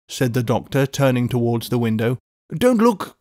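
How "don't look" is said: high, sharp urgent cry. A narrator's reading. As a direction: cry out to stop him. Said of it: "cried Mister Lorry".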